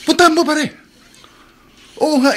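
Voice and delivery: don't let him refuse; insistent urging. Acted. Urging friend again. (mapilit na pag-udyok, mataas ang boses)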